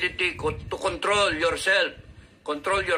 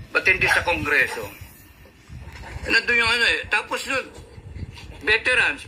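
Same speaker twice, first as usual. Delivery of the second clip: ordinary talk, nothing marked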